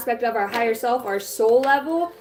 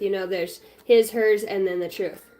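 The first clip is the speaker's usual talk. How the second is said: ordinary talk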